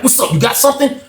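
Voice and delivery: Shaggy eagerly cutting in, high-pitched; eager friend. Retold or imitated. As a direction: jump in before he finishes. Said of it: "he like".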